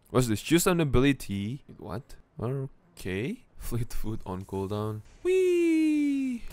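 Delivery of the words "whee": playful whee. Real, not acted. Fleet foot speed.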